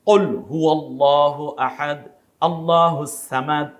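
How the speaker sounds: slow, recited আল্লাহু সামাদ with the wrong s; mock careless tilawat; slow recitation with a letter error; faulty recitation. Shown as a demonstration. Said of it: "তিলাওয়াতটা ঠিক নাই".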